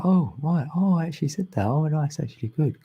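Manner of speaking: high, delighted realisation; a surprised little lift at your own words; pleasantly surprised. Retold or imitated. Retelling hearing her words read back, surprised and pleased.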